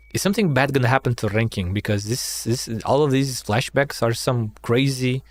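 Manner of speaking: normal talking voice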